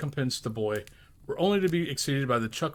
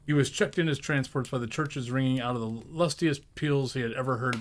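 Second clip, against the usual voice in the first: plain reading voice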